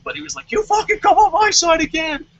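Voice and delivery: ordinary talk, nothing marked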